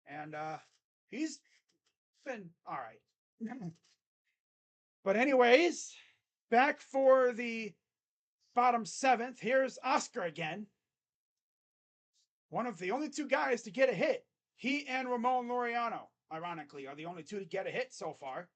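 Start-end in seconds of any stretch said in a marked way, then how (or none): 5.0-16.1 loud, slowed announcer call of the inning; play-by-play call. Acted. Fan streaming the game calls the next batter, announcer style. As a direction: boom it out like a TV broadcaster.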